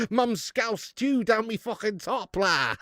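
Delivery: slow, high-pitched comic Scouse. Pretend. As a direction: stretch the parody lyric out in Scouse. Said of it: "Do some [name], but in Scouse".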